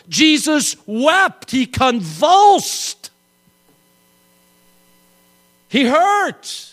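slow, high, emphatic words; slow proclaiming. Real, not acted. Preacher proclaiming that Jesus wept, slow and high. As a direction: lean on each short phrase.

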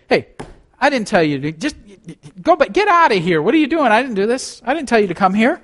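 high, incredulous mock rebuke; imagined scolding God. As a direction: play God baffled, shooing him off. Preacher voices God scolding Elijah.

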